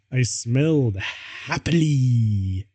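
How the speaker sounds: usual speaking voice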